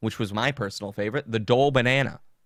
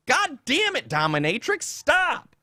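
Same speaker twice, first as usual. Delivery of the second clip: mock yell, much higher, God damn it; exasperated yell; 14 semitones higher, slower than usual. Seeing the dominatrix's tweets in his feed, a high exasperated yell. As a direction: cry out stop at the screen, pitched way up. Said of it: "and you're like".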